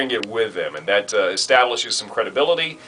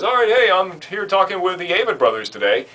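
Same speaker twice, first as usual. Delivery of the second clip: high, upbeat interviewer greeting; lightly voiced TV interviewer; 9 semitones higher than usual. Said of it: "and the interviewer says".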